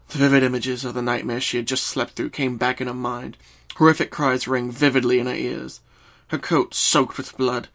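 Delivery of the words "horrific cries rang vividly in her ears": ordinary delivery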